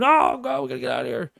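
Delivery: high-pitched hammed-up panic cry, easing off; mock scream. Lone naked runner fleeing through Times Square, mock screaming. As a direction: imitate a screaming runner, highest on the first words. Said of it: "running, screaming".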